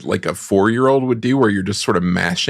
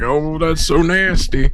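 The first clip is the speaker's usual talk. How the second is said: high, slow mock disgust; disgusted rich person; 7 semitones higher, slower than usual. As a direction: sneer it like a snooty rich lady, drawn out. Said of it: "the rich people are like".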